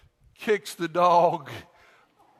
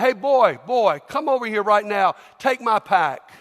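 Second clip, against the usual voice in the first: barking Roman soldier; 3 semitones higher, 6 dB louder than usual. Acted. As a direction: order him like a soldier.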